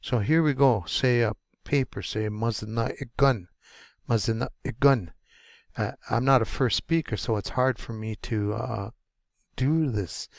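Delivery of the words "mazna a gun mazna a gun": "Mazna a gun" with breath cut dead after "Mazna"; glottal stop. Shown as a demonstration. Teacher demonstrating the little mark, breath stopped mid-word. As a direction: stop the airflow completely before "a gun". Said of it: "It's gonna stop".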